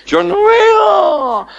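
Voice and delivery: agudo y lloriqueado, lento; siervo quejoso. Acted. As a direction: queja desconcertada en falsete. Siervo se limpia los anteojos. (lloriqueo agudo del siervo que no ve)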